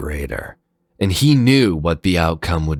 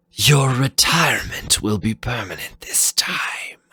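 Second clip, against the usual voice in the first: whispered menace; 8 semitones higher than usual. Acted. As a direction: hiss it like a threat. Fafnir threatens Zero.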